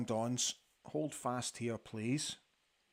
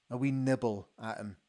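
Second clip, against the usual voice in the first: ordinary talk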